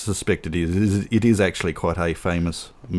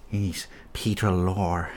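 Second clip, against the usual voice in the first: slow, softer, bad impersonation; Peter Lorre; 6 dB quieter than usual. Host naming a favourite actor, slow bad impersonation. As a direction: do a poor actor impression, drawn out and quiet. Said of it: "that was a really bad impersonation of Peter Lorre".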